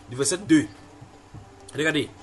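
ordinary talk, nothing marked

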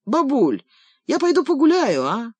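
put-on young grandson wheedling grandma; boy's voice. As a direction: plead like a kid who wants to play. Boy voiced begging grandma.

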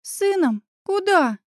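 a touch of outrage, pitched high; indignant high questions from a friend. A narrator's reading. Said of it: "возмутилась Оля".

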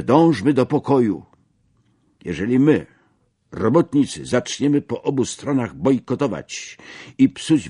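agitator addressing a workers' rally; acted fiery oration with deliberate pauses; rousing political speech.

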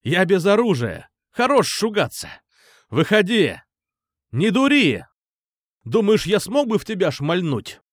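high-pitched, persuasive shouting; calling out. A narrator's reading. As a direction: coax him out of hiding. Shouting to coax friend out.

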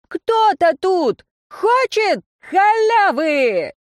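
high, slow crowd-pumping shout; rally speech. A narrator's reading. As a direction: pump the crowd up. Singer rousing crowd.

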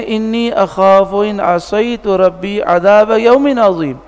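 slow, melodic Arabic recitation; Quranic recitation. Acted. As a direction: chant the verse slowly and reverently.

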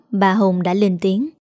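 plain reading voice

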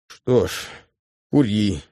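faint dying-man voice; lightly voiced, as if dying; голос умирающего. A narrator's reading. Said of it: "голосом умирающего говорит Лев". (слабым голосом умирающего)

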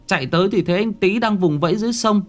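put-on a child hurrying through what happened; child's voice. A narrator's reading.

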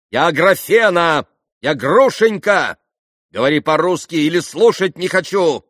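loud, high, insistent correction; angry. A narrator's reading. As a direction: correct him at the top of your temper. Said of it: "Она вся покраснела от гнева". (гневно, громко, с напором)

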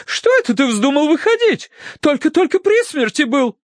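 very high, scolding wail; alarmed cry. A narrator's reading. Wife scolds husband who was near death, high-pitched alarmed cry. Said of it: "вскричала миссис Меринг".

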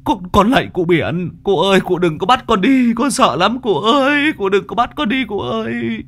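high, loud frantic begging; panicked pleading. A narrator's reading. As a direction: beg the ghost in terror. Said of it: "Anh Bảo lúc này hoảng loạn nói lung tung". (giọng hoảng loạn van xin)

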